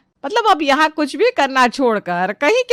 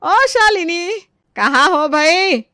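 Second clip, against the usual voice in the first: rising call across the house, higher on ओ; 5 semitones higher, slower than usual. Acted. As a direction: call for her from another room, drawn out at the end. Mother-in-law calls for daughter-in-law. (ऊँची आवाज़ में पुकारना)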